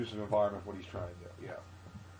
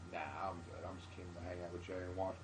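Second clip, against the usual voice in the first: reluctant refusal; 3 semitones lower, 10 dB quieter than usual. Retold or imitated. Reluctant comic declines to perform.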